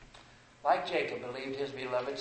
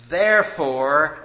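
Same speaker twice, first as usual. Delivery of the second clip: "therefore" slow and much louder with a rising end; drawn-out repeat; 3 semitones higher, 14 dB louder, much slower than usual. Acted. Preacher repeating "therefore" to the congregation, slow and much louder. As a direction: stretch out "therefore" and lift it at the end.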